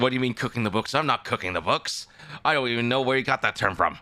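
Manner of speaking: high flustered protest. Acted. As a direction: protest your innocence, flustered. In-character denial to chat.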